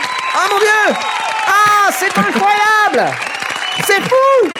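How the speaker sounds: excited shriek. Real, not acted. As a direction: shriek with joy at a guest. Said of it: "les gens ont saturé, euh, là, en hurlant".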